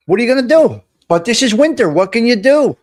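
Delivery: loud fed-up rant about the weather; exasperated outburst. Real, not acted. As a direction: throw up your hands at the winter.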